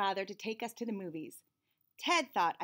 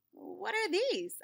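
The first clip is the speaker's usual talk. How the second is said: puzzled; 8 semitones higher, much slower than usual. Acted. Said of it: "I asked".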